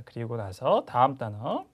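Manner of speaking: normal talking voice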